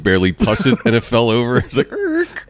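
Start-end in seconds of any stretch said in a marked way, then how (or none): 1.9-2.5 high mock brake screech; screech noise. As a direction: squeak it like brakes. Imitating car touching sign.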